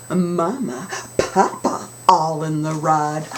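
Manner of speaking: ordinary delivery, nothing marked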